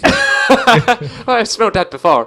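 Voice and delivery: hammed-up Irish lilt, slow through laughter; laughing while imitating his Irish mum; mock Irish mum. Mum sniffs and recognises Katie Price, mock Irish accent. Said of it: "My mum ain't even Irish".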